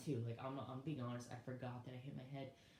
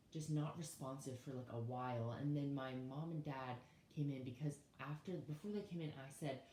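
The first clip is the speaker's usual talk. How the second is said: usual speaking voice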